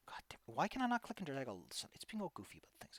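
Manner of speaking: whispered in annoyance; frustrated whisper. Real, not acted. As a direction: grumble it under your breath. GM frustrated with software.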